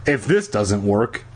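ordinary delivery, nothing marked

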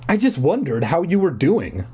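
ordinary delivery

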